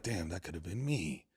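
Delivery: low, soft, slow aside; mock rueful mutter. Host imagines seeing an old flame, murmuring low. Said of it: "been like".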